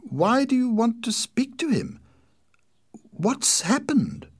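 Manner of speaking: high, loud anxious questions, slowing at the end; very worried. A narrator's reading. Sister demands news from inspector, very worried. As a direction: let worry push the voice high. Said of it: "She was very worried".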